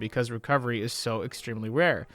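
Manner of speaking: normal talking voice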